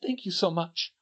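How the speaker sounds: usual speaking voice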